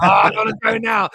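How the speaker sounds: loud, high, comic backing out; mock-alarmed refusal. Retelling his reaction to animals that could kill, mock alarm. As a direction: blurt it out in mock panic.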